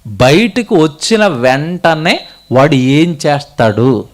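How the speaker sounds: usual speaking voice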